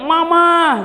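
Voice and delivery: put-on high, loud call of a child across the mall; a small child shouting Mama in a crowded mall. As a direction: yell it like a kid spotting mum. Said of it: "anak saya teriak".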